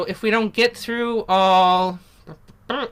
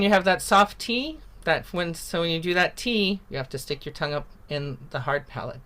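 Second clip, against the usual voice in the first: normal talking voice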